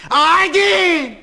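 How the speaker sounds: long drawn-out shout of آیدین; a booming slow call of آیدین; loud bellow. Shown as a demonstration. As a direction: show who's the master of yelling, bellowing آیدین. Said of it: "بنا به صدا بردن بالا باشه من استادشم".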